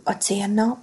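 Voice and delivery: plain reading voice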